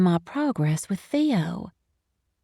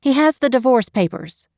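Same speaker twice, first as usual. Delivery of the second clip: higher, louder, sharp interruption; sharp cut-in; 5 semitones higher, 5 dB louder than usual. A narrator's reading. Wife interrupts boyfriend about divorce papers, sharply. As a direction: cut in over the objection. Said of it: "I cut in sharply".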